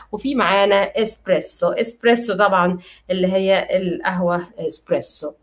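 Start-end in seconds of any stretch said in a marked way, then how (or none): none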